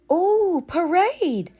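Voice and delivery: put-on high, loud excited child; lightly voiced thrilled girl. A narrator's reading. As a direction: squeal about the parade. Sarah excited.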